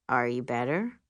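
put-on low, concerned boyfriend; deep-voiced gentle check-in; Will. A narrator's reading. Boyfriend asking after her health, deep and concerned. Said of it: "A concerned boyfriend".

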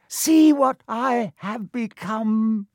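high, loud, slow proclamation; high villain voice. A narrator's reading. The face shows itself to Harry, high and grand. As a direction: declare it in an eerie high voice. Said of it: "a high voice spoke".

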